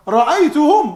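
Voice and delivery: "Ra'aytuhum" high and loud; gulped emphasis. Retold or imitated. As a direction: hit "Ra'aytuhum" as if swallowing hard. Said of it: "it's like he's gulping".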